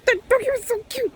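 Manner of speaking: very high, fast squeal. Real, not acted. Repeating how cute the doggy was, squealed baby talk. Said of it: "talk to babies like that".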